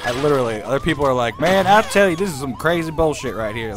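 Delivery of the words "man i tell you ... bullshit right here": loud mock Florida local, louder at the start; a light Florida accent imitation. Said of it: "their accents are all over the place".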